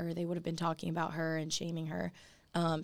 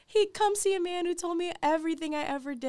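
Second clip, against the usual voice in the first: excited; 11 semitones higher than usual. Retold or imitated. Voicing excited woman.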